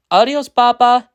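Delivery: put-on high, loud child voice; children's goodbye. A narrator's reading. Children answer father's goodbye.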